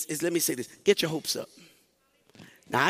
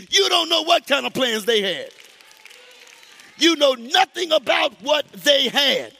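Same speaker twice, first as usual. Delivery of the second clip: loud, higher shouted sermon; full-force preaching; 7 semitones higher, 4 dB louder than usual. Acted. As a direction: preach it hard at the congregation.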